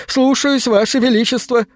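high, snappy obedient yes-sir; eager servant voice. A narrator's reading. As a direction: leap to attention and answer. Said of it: "камень подскочил бы со словами".

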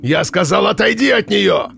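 high booming barked order. A narrator's reading. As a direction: snap the repeated command. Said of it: "рявкнул дядя Митя".

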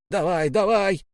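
high-pitched hurry-up call; urging on. A narrator's reading. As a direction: hurry the line along. Officer hurrying sailors along.

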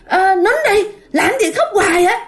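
put-on a boy irritated, telling the noise to hush; annoyed kid snapping. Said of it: "tức lên, nó nói". (giọng đứa nhỏ bực bội quát cho nín)

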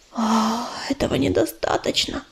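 scared, soft unvoiced whisper; frightened whisper. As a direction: whisper it in fear. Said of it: "испуганно прошептала".

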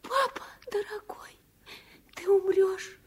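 frightened whispered plea, close to crying; a scared little girl whispering to her father; tearful whisper. Acted. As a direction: whisper it through rising tears. Girl fears father will die.

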